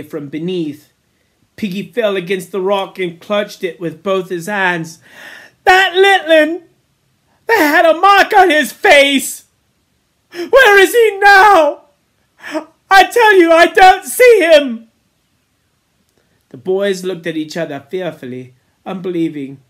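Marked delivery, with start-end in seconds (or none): 5.6-14.9 high, loud, anguished shouts; panicked crying out. A narrator's reading. Piggy begs the boys to find the child, panicked cries. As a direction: gasp and wail in desperation.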